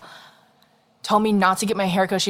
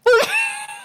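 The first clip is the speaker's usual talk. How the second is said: high shrieking burst of laughter; squealing laugh. Real, not acted. Laughs at awkward name moment.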